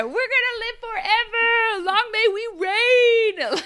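very high, loud regal cry dissolving into laughter; hammed-up long-live-the-queen voice; mock royal proclamation. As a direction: proclaim it like a giddy monarch. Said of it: "their [name] track".

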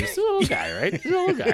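very high, sweet voice for something tiny; doting on a little country, in a high cutesy voice; cutesy baby talk. Pretend. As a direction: coo it like talking about a puppy.

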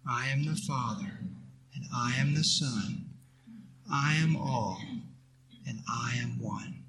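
soft, steady unison prayer; leading a group recitation. Shown as a demonstration. Teacher leading students in a mantra, soft and reverent. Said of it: "Say that with me".

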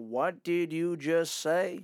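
drawn-out, very slow question; imitating slow out-of-state talkers. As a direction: stretch every word out slowly. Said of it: "they talk so slow".

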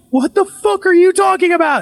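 very high, distraught imitation of wife; retold panicked disbelief. Wife hearing about the strange name, high and panicked. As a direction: imitate frightened confusion, pitch soaring. Wife told what she said.

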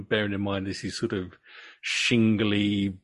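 normal talking voice

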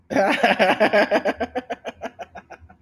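long, high cackle that trails off; cackling. Real, not acted. Hearing co-host's Spanish nickname, cackling. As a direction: crack up loud, then fade.

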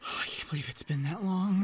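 hushed, breathy whisper of disbelief; barely voiced, saddened murmur. Real, not acted. Whispering to the co-host about Robin Williams' death, hushed.

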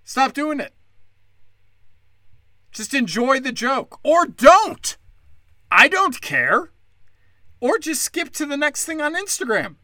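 dismissive. Real, not acted. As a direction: wave them all away. Dismissive rising exasperation.

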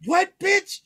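high-pitched incredulous retort; startled disbelief. Retold or imitated. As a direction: throw it back in shock, voice shooting up. Retold startled reply to her scream.

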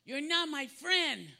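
imitating a cross little girl of two; toddler voice. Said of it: "two years old".

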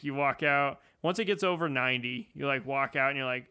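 ordinary talk

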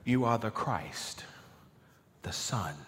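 weighty answer sinking toward a whisper; quietly emphatic. Acted. Simon answers Jesus for the whole group, quietly emphatic. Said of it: "an emphatic answer".

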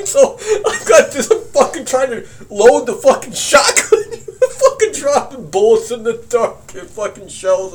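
loud, high, laughing storytelling; worked-up, cracking up mid-story; laughing while talking. Real, not acted. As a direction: laugh through the panic you are retelling. Laughs retelling his panicked fumbling.

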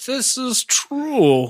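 high, slow, otherworldly sage; solemn oracle. Pretend. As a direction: intone it like a mystic oracle.

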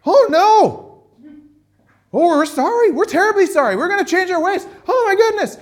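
high, loud, frantic apology; hammed-up terrified repenting crowd; panicked city of Nineveh. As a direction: wail it like a whole city in panic. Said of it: "The entire city of Nineveh goes".

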